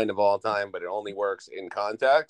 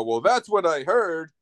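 higher, drawn-out movie quote; slow, pitched-up retort; Bill Murray; 7 semitones higher, slower than usual. Retold or imitated. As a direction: imitate an off-screen quip, slowly. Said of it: "you just hear Bill Murray go".

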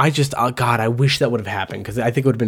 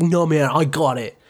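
cocky dude voice; 3 semitones higher than usual. Retold or imitated. Imitating cocky guy.

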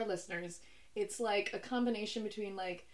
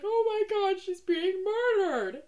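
mock panicked gasp; 9 semitones higher, 4 dB louder than usual. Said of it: "everyone's like".

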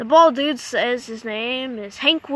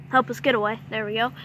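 ordinary delivery

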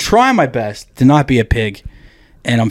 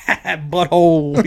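slow savoring drawl; 4 semitones higher, much slower than usual. Retold or imitated. Friend relishing the sight, drawling slowly. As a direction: stretch the word out slowly with relish. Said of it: "I would still be like".

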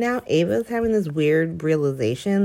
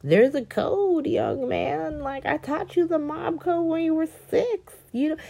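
lightly voiced mob boss lecturing, higher; mock Sonny lecture; 10 semitones higher than usual. Sonny lecturing Spencer about the code, mock stern. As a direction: scold like a mob father. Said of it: "He was just like".

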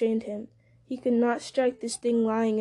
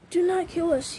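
high, pitiful creature whine; weeping Gollum; 7 semitones higher than usual. A narrator's reading. Gollum begging Sam for mercy, mock weeping. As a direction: plead tearfully in a high creature voice. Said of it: "he wept".

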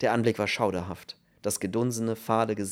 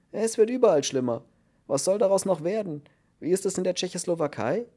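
higher, louder voice of a worked-up prisoner; agitated new cellmate; 9 semitones higher, 4 dB louder than usual. A narrator's reading. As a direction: complain in a high, agitated voice. (aufgeregter Häftling, hohe Stimme)

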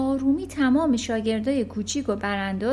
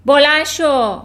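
loud, higher barked order; shout; 4 semitones higher, 13 dB louder than usual. Acted. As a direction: snap the order at him. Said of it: "داد زد". (فرمان بلند و تند)

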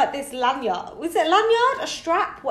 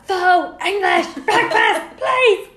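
high, slow, word-by-word shout; exasperated Brit abroad, over-enunciating; louder and louder; 8 semitones higher, 7 dB louder, slower than usual. Acted. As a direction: bellow the order at someone who doesn't understand. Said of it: "It just get louder and louder".

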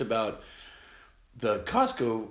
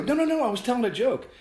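high, loud protest; high-pitched urgent reassurance; retold hasty denial; 7 dB louder than usual. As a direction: jump in to clear up a misunderstanding. Hastily reassuring a worried cashier.

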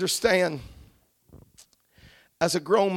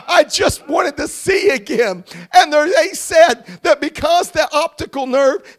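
fervent preaching; 6 semitones higher, 12 dB louder than usual. Real, not acted. Preacher testifying of restored sight, fervent and building to shouts. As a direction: build from pleading testimony to full-force shouted praise. Preaches his healing to the congregation.

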